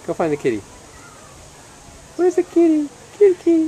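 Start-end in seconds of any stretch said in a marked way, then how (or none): 2.2-3.7 sing-song squeal to the pup. Real, not acted. High baby talk to dog.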